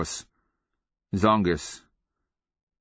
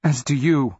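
woman's voice; 8 semitones higher, 5 dB louder than usual. Acted. Woman answers reunion.

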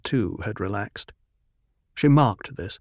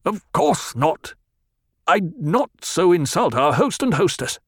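stiffly; 8 semitones higher, 3 dB louder than usual. Acted. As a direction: force the words out rigidly. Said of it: "spoke stiffly".

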